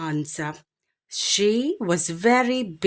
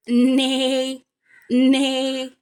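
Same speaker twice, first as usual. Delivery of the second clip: high, louder whinnying neigh; horse neigh; 6 semitones higher, 5 dB louder than usual. Acted. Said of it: "said the horse".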